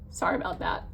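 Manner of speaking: real tearful apology after a sigh; getting emotional. Real, not acted. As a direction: apologize while collecting yourself. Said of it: "sorry, I'm getting all emotional".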